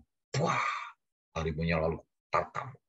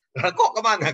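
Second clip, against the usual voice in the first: much higher, louder startled question; mock alarm; 12 semitones higher, 6 dB louder than usual. Preacher retelling his question about the escaped crocodile, mock alarm. Asks where crocodile went.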